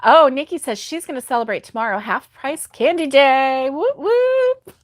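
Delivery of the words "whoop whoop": high-pitched celebratory whoop; playful cheer. Real, not acted. Host cheering viewer's candy celebration, playful cheer. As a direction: whoop it up in a much higher voice.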